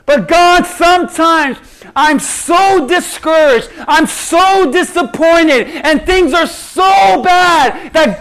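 slow, loud, high anguished plea; desperate outcry to God; anguished cry. Acted. Prayer voiced at full force.

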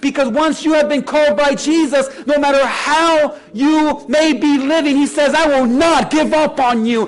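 loud, high, driving sermon delivery; full-force preaching. Real, not acted. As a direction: preach it at the top of your voice, leaning on key words.

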